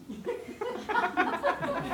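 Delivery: high laughing burst; burst of laughter. Real, not acted. Speaker laughs at her own punchline, high and bright.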